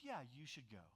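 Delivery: low, quiet, loaded mock permission; false approval, imitated. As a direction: imitate a wife quietly saying yes but meaning no. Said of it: "you're actually gonna be in trouble".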